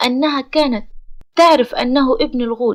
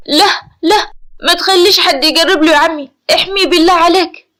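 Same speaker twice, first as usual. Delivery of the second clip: higher, louder frightened pleading; fearful; 5 semitones higher, 5 dB louder than usual. A narrator's reading. Nourseen begging her uncle to protect Amer, fearful. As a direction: beg in acted fear. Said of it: "نورسين بخوف".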